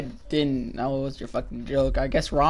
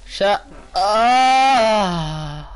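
higher, louder mock shout; mocking imitation; 6 semitones higher, 5 dB louder than usual. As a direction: parody a friend's outburst. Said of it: "That's what you sounded like".

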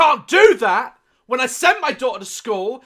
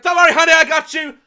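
mock heroic wife; 6 semitones higher, 9 dB louder, faster than usual.

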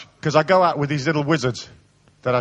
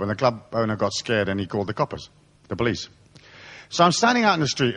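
ordinary talk

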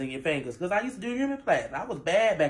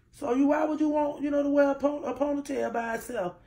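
indignant high; 9 semitones higher than usual. Real, not acted. Mocking kids who picked on her.

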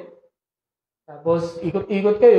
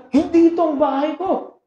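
mild protesting cry; 8 semitones higher than usual. Retold or imitated. Mother-in-law protesting loudly.

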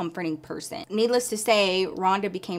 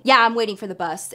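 attitude; 2 semitones higher, 8 dB louder than usual. Retold or imitated. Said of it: "I'm giving him attitude".

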